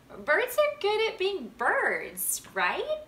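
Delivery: Max, high and hesitant, guessing for Grandpa; unsure young Max. A narrator's reading. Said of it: "a little unsure of himself".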